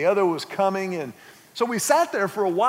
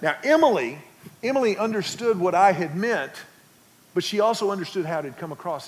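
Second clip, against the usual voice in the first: ordinary talk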